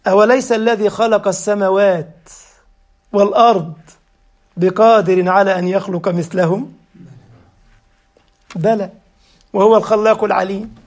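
melodic tajweed chant; chanted Quran verse in a sermon; Quran recitation. Acted. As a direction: recite the verse with measured cantillation.

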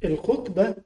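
الخطبة pronounced KHUT-ba; damma on the kha. Shown as a demonstration. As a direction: round the first vowel of الخطبة to a short u. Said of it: "بضم الخاء يعني الموعظة".

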